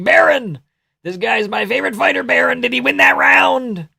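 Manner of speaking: high, stretched-out mock shout; Trump. Imagined spectator asking his son about the round, mock shouting. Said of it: "Trump asking".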